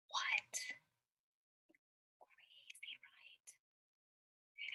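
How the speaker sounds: barely audible, incredulous whisper; whispered disbelief. Real, not acted. Reader reacting to the banana claim, whispered disbelief. Whispered aside to young listeners.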